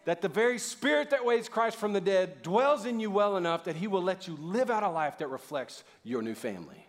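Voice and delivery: usual speaking voice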